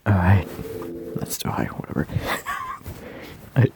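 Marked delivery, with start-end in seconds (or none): 1.2-2.1 whispering. Acted. Back to whisper.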